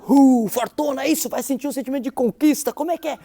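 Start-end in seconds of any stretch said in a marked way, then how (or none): 0.1-1.1 loud, high cry of victory; acted triumph. Shown as a demonstration. Parent acting out triumph for the child. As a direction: show the joy of winning. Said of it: "Faz aí o teatro pra mim".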